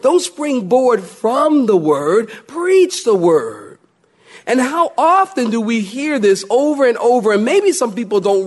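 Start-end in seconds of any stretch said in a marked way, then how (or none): 0.0-3.7 slow, weighty proclamation; preaching. Acted. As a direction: drag it out with full gravity. Preaching climax.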